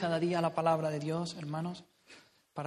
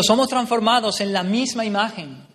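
declaimed scripture, louder and higher; loud quote; 4 semitones higher, 10 dB louder than usual. Real, not acted. Preacher reading the verse aloud to the church, proclaiming.

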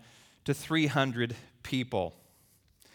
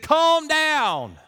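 firm command; 11 semitones higher, 8 dB louder than usual. Retold or imitated.

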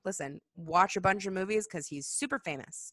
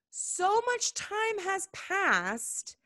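very slow, much higher, stretched words; dramatic drawn-out; 12 semitones higher, much slower than usual. Shown as a demonstration. Stressing how long ago, very slow and high.

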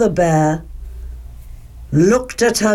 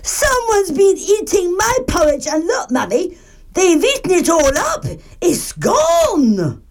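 high, tearful little-bear voice; storybook baby bear's high-pitched complaint; crying Baby Bear; 11 semitones higher than usual. A narrator's reading. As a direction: wail it to mummy like a small cub. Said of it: "and cried".

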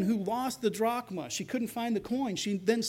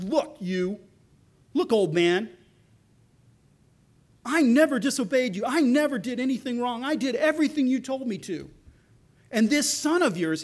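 louder and higher, indignant outburst; angry rebuke; 4 semitones higher, 6 dB louder than usual. Retold or imitated. Older brother yelling at his father, loud and bitter. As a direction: jab each line at him.

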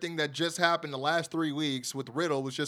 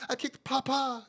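imitating Grayson Waller; 8 semitones higher than usual. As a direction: parody the wrestler saying "Papa", higher pitch. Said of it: "That's how he said it".

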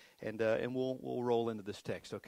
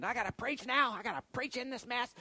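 much higher, mock-panicked inner voice; panicked thought; 12 semitones higher than usual. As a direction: squeak out the dread. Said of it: "I'm thinking".

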